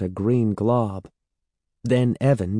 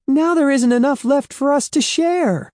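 high, loud frustrated complaint; upset; 4 dB louder than usual. A narrator's reading. Andy fists clenched complaining about the lost stuff. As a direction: protest that it's all gone.

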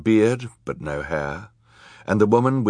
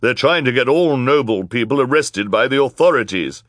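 loud, higher wicked woman's voice; villainess accusing; 6 semitones higher, 6 dB louder than usual. A narrator's reading. Villainous woman turning the crowd against the children, loud accusation. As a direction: cry the accusation to everyone.

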